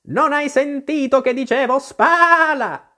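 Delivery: high, loud, indignant sheep; angry shout. A narrator's reading. The sheep berates Alice for ignoring orders, high angry shout. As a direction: yell it crossly, stretching the final word. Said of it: "gridò rabbiosamente la pecora".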